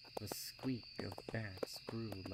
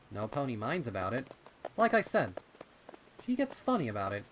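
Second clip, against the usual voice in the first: put-on louder, fast kid voice; colt voice; 3 semitones higher, 10 dB louder, faster than usual. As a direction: brush it off like a young colt. Rumble reassuring Pip.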